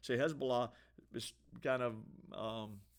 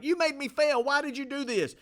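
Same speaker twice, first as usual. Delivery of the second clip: high, loud mock yell; much higher and louder, a hammed-up furious yell; yelling at chatbot; 12 semitones higher, 9 dB louder than usual. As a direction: yell at the chatbot as if it ruined everything. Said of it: "I'll yell at it".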